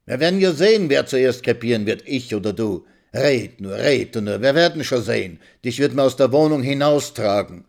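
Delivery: put-on old woman threatening the young wife; mild threatening retort. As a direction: threaten her back.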